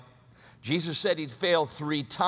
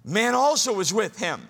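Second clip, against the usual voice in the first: raised, higher accusing callout; acted accusation; 6 semitones higher, 5 dB louder than usual. Servant girl pointing out Peter to others, accusing. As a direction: point someone out, pitched up. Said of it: "Doesn't sound like she said it".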